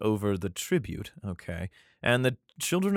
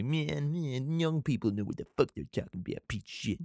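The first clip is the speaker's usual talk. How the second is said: muffled mock rant, trailing off quieter; hammed-up grumbling king; 3 semitones higher, 7 dB quieter than usual. King ranting about what young people know, mock grumbling. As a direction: grumble it under your breath in a silly voice. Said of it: "But he's like".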